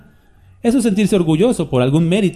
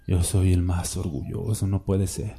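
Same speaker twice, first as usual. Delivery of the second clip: much lower and softer, a dismayed confession; dismayed humble man; 8 semitones lower, 9 dB quieter than usual. Retold or imitated. The humble man quietly admits his own pride. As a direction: admit it quietly, almost to yourself. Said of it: "Y el humilde dice".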